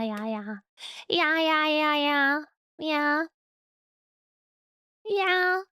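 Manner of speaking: ordinary talk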